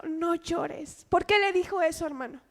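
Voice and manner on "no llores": suave, bajo y tierno; consoling words. Acted. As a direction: consuela a quien llora. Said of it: "con palabras de consuelo". (palabras de consuelo, dichas con ternura y en voz baja)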